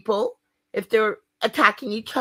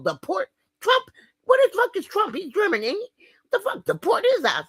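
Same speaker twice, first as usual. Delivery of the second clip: outraged disbelief; 12 semitones higher than usual. Real, not acted. Outraged rant about Trump.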